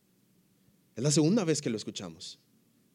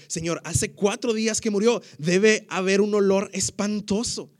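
higher, louder protest; 5 semitones higher, 5 dB louder than usual. Acted. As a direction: object with alarm. Martha protesting, reread.

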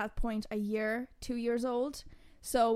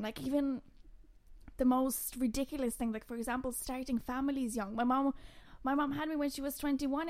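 ordinary delivery

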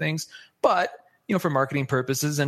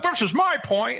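pitched way up and shrill; shrill high voice; 10 semitones higher than usual. Shown as a demonstration. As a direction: squeak the point out high and thin. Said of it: "I sound a little shrill sometimes".

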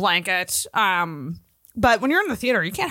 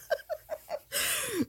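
a high, breathy burst of laughter, falling away; high squealing laugh; 12 dB quieter than usual. Real, not acted. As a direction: let a squeal of laughter break out and fade. Laughs at own joke.

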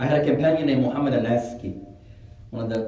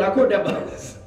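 laughing punchline; 7 semitones higher than usual. Real, not acted. Punchline of retold escape, laughs.

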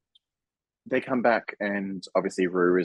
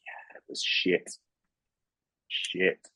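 whisper; 2 semitones lower, 5 dB quieter, much slower than usual. Real, not acted. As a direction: breathe it out as a secret. Whispered verdict.

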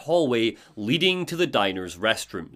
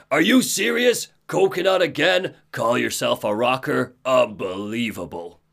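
Tor, scornful. Acted. Tor berating his brother's song choice, incredulous then disgusted. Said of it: "He's like".

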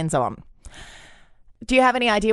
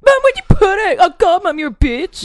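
high loud bratty yell; yelling across the room; 13 semitones higher, 9 dB louder than usual. Pretend. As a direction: bellow at mom like a spoilt brat. Spoilt son yelling at mom.

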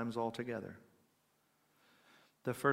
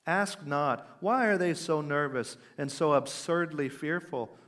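emphatic preaching, somewhat raised; quoted exhortation; 3 semitones higher, 7 dB louder than usual. Retold or imitated. Preacher quoting a plea for the despondent, emphatic exhortation. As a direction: urge the congregation, weighting each command. Reads sermon quotation aloud.

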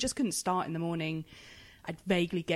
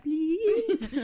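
much higher cheeky little plea; pleading toddler; 11 semitones higher, 4 dB louder than usual. Retold or imitated. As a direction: beg sweetly like a toddler. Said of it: "was like".

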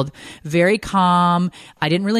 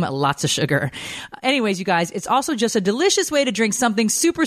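upbeat, higher-pitched sponsor read; 4 semitones higher than usual. Acted. Host selling matcha lattes to listeners, scripted ad read. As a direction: sell it with lilting emphasis on key words.

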